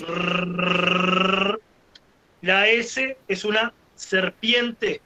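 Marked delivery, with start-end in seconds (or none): 0.1-1.6 long rolled R like an engine; sustained tongue-trill "rrrr"; motorbike trill. Shown as a demonstration. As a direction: imitate a motorbike with a held R. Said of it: "Es un ratón en moto".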